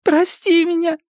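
put-on high, slow tearful childlike plea; sobbing child. A narrator's reading. Girl to Erik while being dragged away, high sobbing voice. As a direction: apologise through sobs. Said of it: "всхлипывала Мадда".

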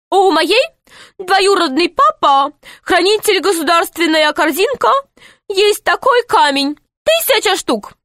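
put-on high, eager boy voice; a bright young boy playing along. Pashka boasts in broken speech.

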